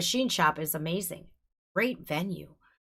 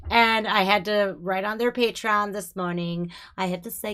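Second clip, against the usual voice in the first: ordinary delivery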